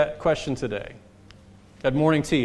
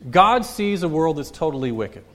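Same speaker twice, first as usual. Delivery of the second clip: normal talking voice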